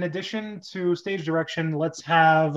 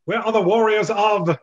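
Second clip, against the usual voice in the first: thunders; 2 semitones higher, 4 dB louder than usual. Acted. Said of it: "[name] thunders".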